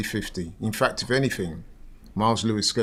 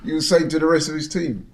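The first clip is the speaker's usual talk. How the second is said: laughing; 6 semitones higher than usual. Real, not acted. Laughing at the player.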